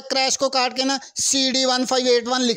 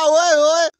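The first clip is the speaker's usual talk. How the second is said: ओए, ओए yelled higher and louder; a sudden alarmed yell; alarmed shout; 4 semitones higher, 6 dB louder, much slower than usual. Real, not acted. Watching the car hit dangerous jumps, alarmed shout.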